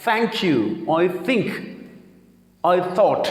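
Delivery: breath between tongue and teeth in thank, think, thought; standard TH. Shown as a demonstration. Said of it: "کے بیچ میں آواز".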